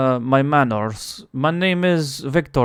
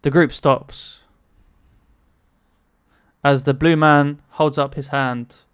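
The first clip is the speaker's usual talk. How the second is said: ordinary talk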